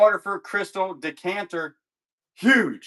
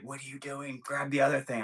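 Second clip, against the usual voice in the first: hushed backstage prompt; 4 semitones lower, 11 dB quieter than usual. Retold or imitated. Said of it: "Kayla's like".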